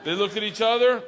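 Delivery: ordinary talk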